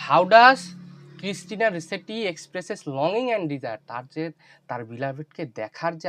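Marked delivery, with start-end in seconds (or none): 0.1-3.8 louder repeat. Real, not acted. Teacher repeats question to inattentive students, calling it out loud. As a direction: repeat the question loudly so they finally hear. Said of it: "যখন প্রশ্ন ধরি তখন তো শুনতে পাও না".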